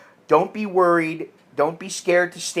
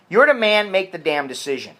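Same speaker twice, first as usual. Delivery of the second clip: normal talking voice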